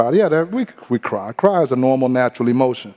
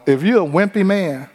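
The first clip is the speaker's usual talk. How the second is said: usual speaking voice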